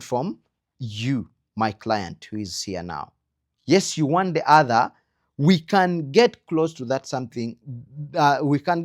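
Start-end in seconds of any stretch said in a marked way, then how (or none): none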